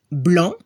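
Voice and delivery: blanc with no K sound at the end; low French blanc, C left silent; silent final C. Shown as a demonstration. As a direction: end blanc on the nasal vowel. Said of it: "si no escucha la C".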